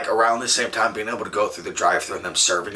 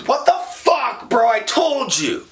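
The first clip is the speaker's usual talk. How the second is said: high-pitched, exasperated mock outburst; mad buddy; 12 semitones higher than usual. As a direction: act out a friend's angry I-told-you-so. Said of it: "he was so mad at me".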